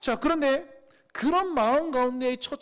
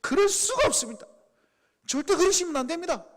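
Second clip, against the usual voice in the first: raised, higher-pitched protest to Jesus; angry protest; 7 semitones higher, 4 dB louder than usual. Retold or imitated. Peter protesting to Jesus, angry and raised. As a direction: Peter pushing back hard, voice up. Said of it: "분노합니다".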